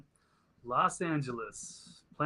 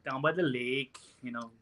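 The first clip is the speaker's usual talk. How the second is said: put-on lightly done Minnesota accent on "lake" and "jiff"; a hint of Fargo-style Minnesota talk; mild Minnesota accent impression; 5 dB louder than usual. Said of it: "Minnesota impression".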